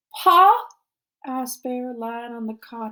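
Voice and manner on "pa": high, loud, startled question; anxious question. A narrator's reading. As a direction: blurt out a fearful question about your dad. Boy asks after missing father.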